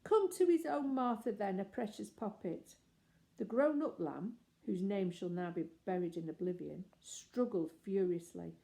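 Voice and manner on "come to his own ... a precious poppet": put-on high, fond baby talk; cooing. Martha cooing to the baby lamb, high and fond. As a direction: coo over the little one.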